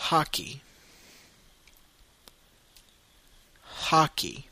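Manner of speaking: hockey said the English way, HAH-key; hockey, English vowel, teacher model; English pronunciation. Shown as a demonstration. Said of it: "deux fois en anglais".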